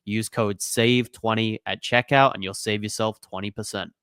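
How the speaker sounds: normal talking voice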